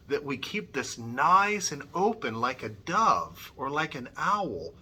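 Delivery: normal talking voice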